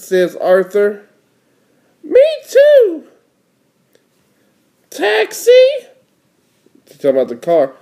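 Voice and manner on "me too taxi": very high cartoon child voice; little sister. Little sister spying words to match her brother, very high voice. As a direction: pitch it way up as a kid sister.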